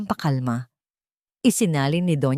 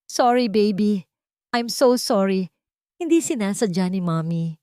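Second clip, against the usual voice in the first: anguished mother's apology; distraught apology; 4 semitones higher than usual. Acted. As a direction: beg the child's forgiveness. Mother apologizes to hurt child.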